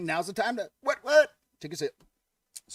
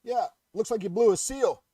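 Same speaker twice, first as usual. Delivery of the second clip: a touch higher and louder, matter-of-fact mechanic; mechanic's verdict; 6 semitones higher, 6 dB louder than usual. Acted. Mechanic telling the penguin the diagnosis, lightly voiced. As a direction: deliver the punchline setup plainly. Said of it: "The mechanic looks up and goes".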